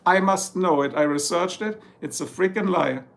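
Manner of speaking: usual speaking voice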